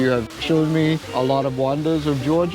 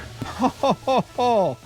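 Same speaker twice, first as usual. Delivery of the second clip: high, punchy run of laughter; real laugh, high-pitched; delighted laugh; 9 semitones higher than usual. Real, not acted. Marvelling at the hillside cave.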